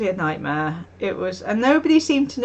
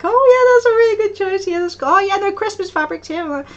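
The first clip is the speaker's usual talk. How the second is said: high, bright and much louder customer-service gush; chirpy shop assistant; 10 semitones higher, 6 dB louder than usual. Pretend. Vlogger imagining herself serving fabric customers, playing a gushing, eager assistant. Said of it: "I could be all like".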